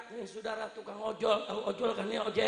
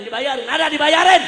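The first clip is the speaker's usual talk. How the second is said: raised, very loud crowd call; shouted question; 6 semitones higher, 19 dB louder than usual. Real, not acted. Preacher asking who gets their bills paid, shouting. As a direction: throw it out loudly to the whole crowd.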